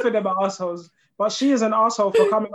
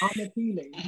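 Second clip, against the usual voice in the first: softly repeating the word, amused disbelief; mocking echo; 7 dB quieter than usual. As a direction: echo the word back, teasing. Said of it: "you used the word unappealing".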